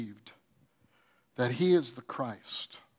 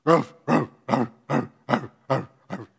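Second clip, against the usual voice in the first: loud imitated dog barks; neighbor's dog barking at night, imitated barks; barking dog imitation; 3 semitones lower, 4 dB louder than usual. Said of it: "you know what they do".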